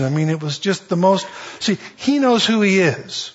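ordinary talk

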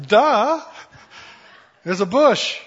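exaggerated duh, stating the obvious; sarcastic duh. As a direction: point out the obvious with a sneer. Mocking Moses's obvious remark.

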